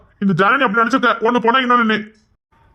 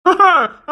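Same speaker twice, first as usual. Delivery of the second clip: embarrassed laugh; 9 semitones higher than usual. Acted. Husband laughs off crying accusation.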